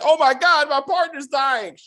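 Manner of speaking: imitated cry of alarm; mock panic. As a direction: act out a badly faked panic over a dying friend. Acting out man feigning panic over partner.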